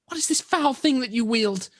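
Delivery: high spirit voice calling out; a spirit recoiling from the blade; unearthly voice. Acted. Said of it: "the voice kinda calls out".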